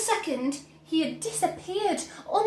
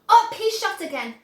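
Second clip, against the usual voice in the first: plain reading voice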